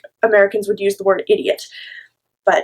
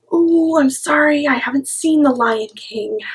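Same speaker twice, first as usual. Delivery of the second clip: higher, drawn-out teasing sorry; mock apology; 5 semitones higher than usual. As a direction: egg on an angry sister with fake contrition. Acted mock apology to sister.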